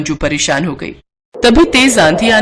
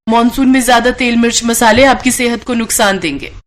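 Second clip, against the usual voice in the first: ordinary delivery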